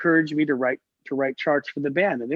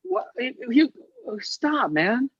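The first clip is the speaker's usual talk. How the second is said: horrified; 9 semitones higher, slower than usual. Retold or imitated. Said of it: "He was like".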